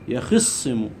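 normal talking voice

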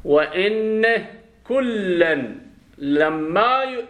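stretched "لما" with a long double m; doubled Quranic reading. Shown as a demonstration. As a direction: recite high and drawn out, holding the n of "إن". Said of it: "لأنها مشددة ما مخففة".

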